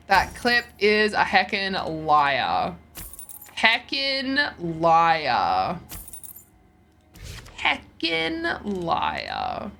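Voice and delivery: drawn-out heckin' liar; mock outrage, very slow; slow mock accusation. Real, not acted. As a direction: stretch every syllable of heckin' liar. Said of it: "repeated drawn-out accusation".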